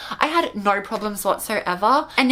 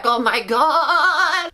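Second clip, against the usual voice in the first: long, wobbling, higher-pitched cry; shaken by the bed; 5 semitones higher, 4 dB louder, much slower than usual. Retold or imitated. Patient on the shaking operating table, juddering cry. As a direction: drag it out as if being jolted. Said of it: "the whole bed's, like, shaking".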